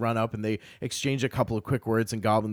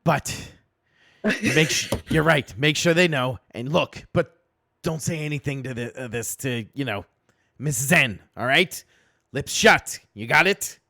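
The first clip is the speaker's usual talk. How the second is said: goblin knight; 5 semitones higher, 7 dB louder than usual. Acted. Knight gives orders and a warning.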